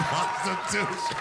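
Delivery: Prostitution, stretched slowly and belted; big punchline. Retold or imitated. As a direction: sell the last word of the sign. Reading the sign as punchline.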